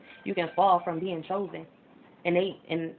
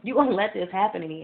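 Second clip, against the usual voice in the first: retold anger at God; 4 semitones higher, 4 dB louder than usual. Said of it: "that's when I was angry with God".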